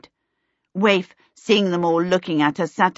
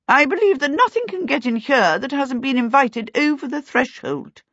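higher-pitched, assured aunt voice; emphatic aunt; 7 semitones higher than usual. A narrator's reading. Aunt Sempronia pronouncing on the house's protection, emphatic.

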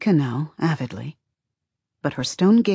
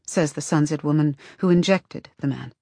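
usual speaking voice

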